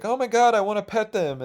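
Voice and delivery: excited squeal. Retold or imitated. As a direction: squeal like you just saw puppies. Excited by the wiener dogs.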